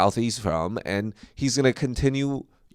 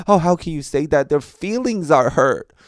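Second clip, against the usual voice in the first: higher-pitched mock outrage; mock offended; 6 semitones higher than usual. Mimicking easily offended people, in mock outrage. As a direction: act scandalized on others' behalf.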